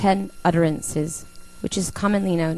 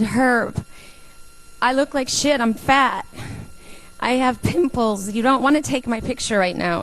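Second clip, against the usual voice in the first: protesting; 5 semitones higher than usual. Retold or imitated. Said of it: "I said".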